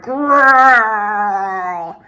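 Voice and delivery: loud growling roar; a bear roaring when his fur is pulled. A narrator's reading. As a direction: let out a big bear growl. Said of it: "Old Bear roared".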